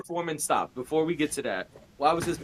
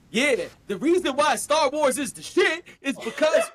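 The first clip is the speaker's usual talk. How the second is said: mock video voice; 10 semitones higher than usual. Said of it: "it'd be like".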